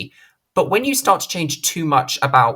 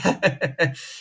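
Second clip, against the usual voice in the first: short laugh; 7 semitones higher than usual. Real, not acted. Laughs at bad timing.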